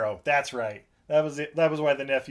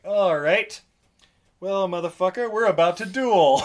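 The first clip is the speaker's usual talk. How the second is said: louder, higher mock challenge; tough commander; 5 semitones higher, 7 dB louder than usual. Commander challenging the nephew to a duel, in a loud mock threat. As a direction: call him out like a bruiser spoiling for a fight. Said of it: "the commander of the army is basically like".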